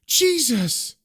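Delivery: acted gasp of alarm; startled fright. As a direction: cry it out as if jolted by fear. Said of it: "she stands up in fright saying".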